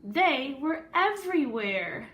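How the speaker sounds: lively read-aloud storytelling voice; story narration. A narrator's reading. Teacher reading a picture book to the class, storyteller voice.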